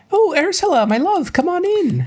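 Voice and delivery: very high, sugary falsetto greeting; Rose's sweet welcome. Acted. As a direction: gush like a doting girlfriend. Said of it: "Rose opens it".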